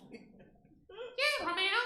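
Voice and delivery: very high puppet squeak. Acted. Elmo answering [name], high squeaky puppet voice.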